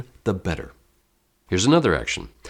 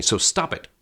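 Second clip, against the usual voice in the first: a pitched-up, irritated snap at the viewers; nervous venting; 9 semitones higher than usual. Shown as a demonstration. Teacher demonstrating an action on his watching students, high nervous snap. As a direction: vent your nerves at them, sharp and high. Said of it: "I chose to play the action to vent my nervousness".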